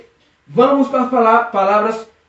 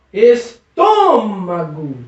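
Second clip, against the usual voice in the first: "estômago" slowly, with a long stretched TÔ; strong syllable TÔ; much slower than usual. Shown as a demonstration. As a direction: pause after es, then lean hard on TÔ in "estômago". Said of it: "Percebeu que existe uma palavra forte aí?". ("estômago" com o TÔ forte e bem prolongado)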